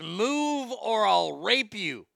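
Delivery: slow, drawn-out mock menace; slow menacing quote. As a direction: stretch each word out like a threat. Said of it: "and he says".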